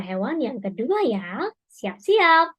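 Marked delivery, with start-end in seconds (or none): none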